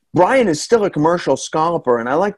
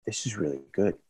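lower, much softer understated friend; imitating Carl; 7 semitones lower, 13 dB quieter than usual. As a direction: murmur it low like an unimpressed buddy. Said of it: "He was like".

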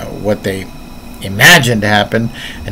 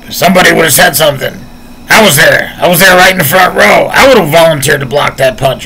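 quick, higher-pitched insistent protest; indignant retelling; 4 semitones higher, faster than usual. Retold or imitated. Voicing the eyewitness in the front row, indignant. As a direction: argue it like an eyewitness who won't have it.

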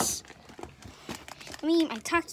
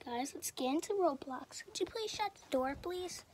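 whisper; 3 semitones lower, 13 dB quieter than usual. Real, not acted. Hushed, door shut.

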